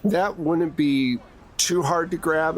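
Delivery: normal talking voice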